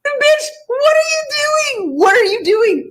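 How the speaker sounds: incredulous shout. Real, not acted. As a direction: yell at her in disbelief. Yells at woman in the clip.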